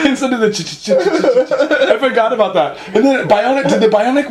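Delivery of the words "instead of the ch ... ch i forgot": laughing. Real, not acted. Laughing through imitating the sound.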